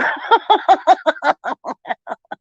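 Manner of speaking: high long laugh. Real, not acted. Laughing to her chat about peeing again, high laugh. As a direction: crack up at herself.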